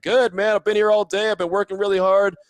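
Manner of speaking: pumped-up coach talking shop; a head coach cheerfully brushing it off; 1 imitating Coach Gruden. Gruden voiced in retold story.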